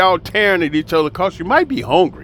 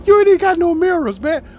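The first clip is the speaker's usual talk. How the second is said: much higher, quicker amused disbelief; high-pitched disbelief; 13 semitones higher, 4 dB louder, faster than usual. Real, not acted. Teasing a brother who has no mirrors, high-pitched disbelief. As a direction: squeal it fast, just after a laugh. Laughing mock disbelief at messy beard.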